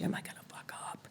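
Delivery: anxious whispered self-doubt; whispering. Retold or imitated. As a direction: whisper it in panic. Nervous inner voice.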